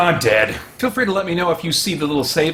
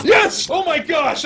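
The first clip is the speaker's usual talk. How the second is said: excited shout; 9 semitones higher than usual. Real, not acted. Answers voice in the dark.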